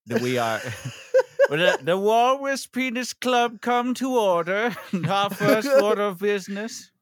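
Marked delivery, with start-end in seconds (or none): 1.5-6.9 mock club chairman. Mock club meeting opening.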